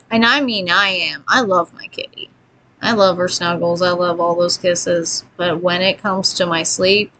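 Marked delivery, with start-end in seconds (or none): none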